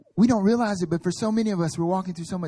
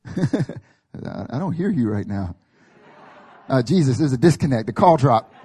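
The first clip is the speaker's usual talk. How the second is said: loud, mock-frustrated caller; mock bad connection; 9 dB louder than usual. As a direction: act like a dropped phone call. Mock believer, bad phone line.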